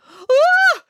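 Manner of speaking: loud, very high yelp; frightened yelp. A narrator's reading. Boris hears talk of becoming a star, frightened yelp. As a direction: yelp out in high-pitched alarm. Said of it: "yelped Boris".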